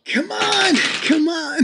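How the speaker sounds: high-pitched excited plea; urging on. Real, not acted. Player rooting for a big roll, excitedly urging.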